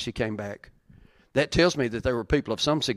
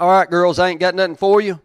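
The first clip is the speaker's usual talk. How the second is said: loud, raised casual Naomi; Naomi paraphrased; 4 semitones higher, 7 dB louder than usual. Pretend. Preacher acting Naomi telling her daughters-in-law to stay, loud and high. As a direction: preach it big as Naomi sending the girls home. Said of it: "She's like".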